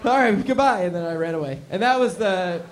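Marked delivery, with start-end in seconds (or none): 0.0-0.8 high, loud, overeager thanks with laughs; nervous gushing exit. Retold or imitated. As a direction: bluff your way off the stage. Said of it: "And I was like".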